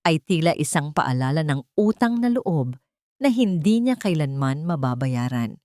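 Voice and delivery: normal talking voice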